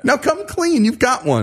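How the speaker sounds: teasing insistence. Real, not acted. Pressing a cohost to confess.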